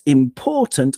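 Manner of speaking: "important" with POR as the strong beat; stress on second syllable. Shown as a demonstration. Podcaster recapping syllable stress, second-syllable stress. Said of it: "obviously the second syllable".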